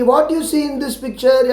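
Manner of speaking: slow, clear question as the teacher asks it; teacher asking the class, slow and clear; teacher's question, slow. Shown as a demonstration. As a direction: ask it slowly like the teacher will. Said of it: "जब मिस पूछेगी".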